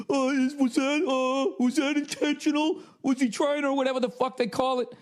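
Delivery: high prissy mocking ref voice; mock the fussy referees, high and fretful; hammed-up high fretting officials. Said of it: "They'd be like".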